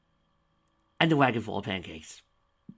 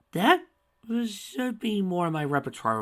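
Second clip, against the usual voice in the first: astonished; 11 semitones higher, 6 dB louder than usual. Real, not acted. Reader's outburst.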